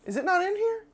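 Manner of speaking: high, puzzled question; puzzled judge. Retold or imitated. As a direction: ask it, genuinely confused. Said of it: "the judge is up there goes".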